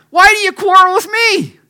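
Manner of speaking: high, loud, pastor-style rebuke; preachy Moses. Acted. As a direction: scold them like a preacher from the pulpit. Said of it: "Sounds like a pastor".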